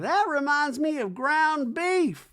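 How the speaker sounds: high, slow hammed-up Reba; lofty slow mock-mom rant. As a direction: drone it like a grand old dame. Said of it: "Reba's like".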